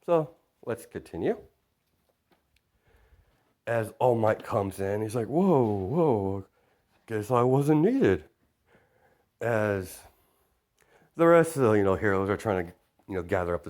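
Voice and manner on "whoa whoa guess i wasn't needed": rising, drawn-out reaction; surprised hero. Acted. All Might arrives to find he wasn't needed, surprised. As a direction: act out mild amazement, stretching the words.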